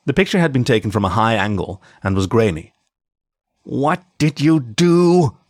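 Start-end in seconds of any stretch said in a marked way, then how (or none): 3.6-5.4 slow, higher-pitched seething demand; drawn-out, tight-jawed anger; through clenched teeth. A narrator's reading. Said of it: "he said through clenched teeth".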